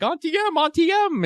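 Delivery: put-on high, sing-out girlish character cry; Dorothy impression. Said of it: "I can't stop thinking like Dorothy".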